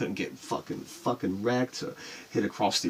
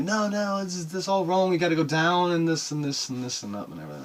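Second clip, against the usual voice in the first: know-it-all friends; 6 semitones higher, 4 dB louder than usual. Retold or imitated. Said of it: "some were like".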